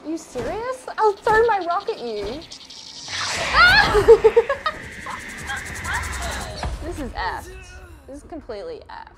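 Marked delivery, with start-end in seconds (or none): 2.3-6.8 loud, high shrieking laughter; hysterical laugh. Real, not acted. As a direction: crack up in terror. Panicked laughter.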